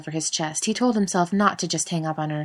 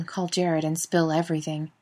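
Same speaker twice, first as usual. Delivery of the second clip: usual speaking voice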